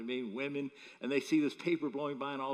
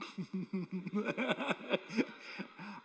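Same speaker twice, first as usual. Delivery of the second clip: long, high chuckling laugh; 5 semitones higher than usual. Real, not acted. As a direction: laugh at your own story. Preacher laughs at own story.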